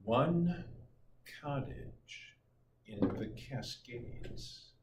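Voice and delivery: soft, slow, breathy whisper; dreamy hush. Shown as a demonstration. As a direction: whisper it like a cozy secret. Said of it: "This is the one that stuck".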